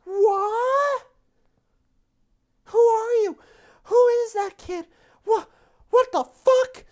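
high, loud startled shrieks; high shrieking shout. Acted. Jolene shrieking confused.

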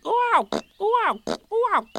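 high squeaky ooh-ow cries; bouncing yelps. A narrator's reading. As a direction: yelp with every bounce. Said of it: "he was saying".

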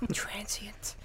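breathy mock whisper of the insult. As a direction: hiss the word transient softly.

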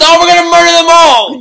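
shrieking high cartoon villain; villain shout. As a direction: scream it like a gleeful movie villain. Mock villain shout from the movie.

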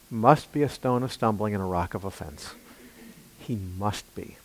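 hushed earnest sermon; quiet preaching. Acted. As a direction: draw them in, hushed.

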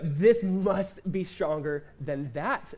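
drawn out, each word weighted; slow it right down and lean on every word; firm, deliberate restatement; slow emphatic declaration. Real, not acted. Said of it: "Like, no".

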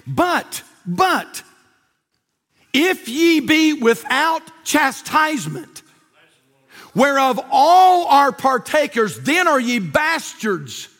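very slow, high, emphatic verse reading; declaimed scripture. Acted. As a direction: draw out each word of the verse.

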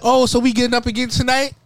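high, loud, quick imitation; mimicking a woman. Imitating a date who calls afterwards, high-pitched mimicry. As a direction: mimic her eager question up high.

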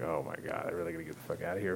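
soft fast fed-up mutter; fed-up sigh. Retold or imitated. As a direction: mutter your way out the door. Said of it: "I was just like".

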